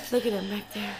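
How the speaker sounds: normal talking voice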